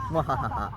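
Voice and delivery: gloating theatrical cackle; evil villain laugh. Acted. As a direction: mwahaha of a cartoon villain.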